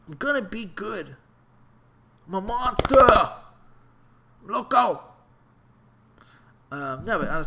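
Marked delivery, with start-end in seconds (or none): 0.1-5.0 much higher and louder, a wild yell; hyped shout. Real, not acted. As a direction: roar it like a monster. Hyping himself up.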